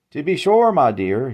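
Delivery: lightly voiced genteel old salesman, politely; polite old man. A narrator's reading. Old salesman greeting Jane politely, mild genteel voice. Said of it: "he said politely".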